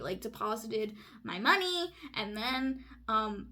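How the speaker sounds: usual speaking voice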